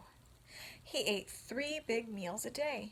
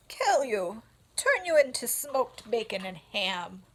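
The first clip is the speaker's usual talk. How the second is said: put-on blunt old sheep; 8 dB louder than usual.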